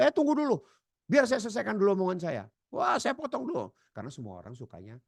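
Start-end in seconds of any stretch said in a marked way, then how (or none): 0.0-2.4 high, loud, fast interruption; cutting in. Acted. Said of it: "kita potong balik". 2.7-3.7 high, pushy interruption; cutting in. Acted. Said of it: "kita potong balik".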